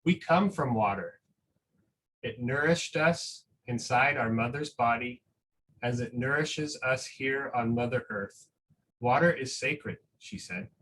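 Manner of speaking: slow, gentle storybook reading with long pauses; reading a picture book aloud. Acted. Teacher reading a picture book to school families, slow and gentle.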